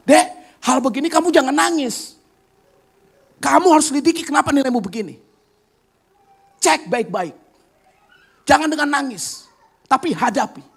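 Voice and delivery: a high, firm big-sister voice. Retold or imitated. Older sister urges crying younger sibling to stop crying, firm and high. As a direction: push her sibling to face it.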